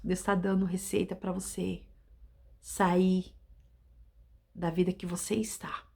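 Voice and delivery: low, soft, near whispered promise; hushed blessing. Acted. Preacher blessing the listener toward a victorious life, hushed. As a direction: close with a quiet, intimate promise.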